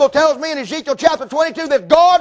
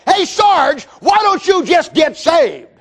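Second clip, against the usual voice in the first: raised, high-pitched plea to a buddy; urgent calling out; 5 semitones higher, slower than usual. Retold or imitated. Preacher urging Sarge to get saved, urgent calling out. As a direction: call out the appeal, stretching the words.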